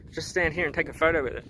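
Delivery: ordinary talk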